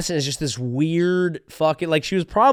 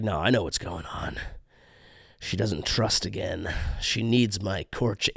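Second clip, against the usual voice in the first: lower, solemn mock; mock serious voice; 5 semitones lower, 6 dB quieter than usual. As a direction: say it with fake gravity. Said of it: "he's like".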